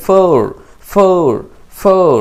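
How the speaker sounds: "ফোর" in American accent with a clear final r; American accent, sound the r at the end of "ফোর". Shown as a demonstration. Teacher showing the American accent version of "ফোর". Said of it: "তাহলে এটা American accent হয়ে যায়".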